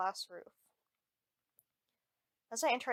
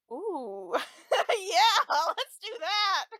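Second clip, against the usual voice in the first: squealing; 11 semitones higher, 10 dB louder, much slower than usual. Real, not acted. Squealing about lingerie store.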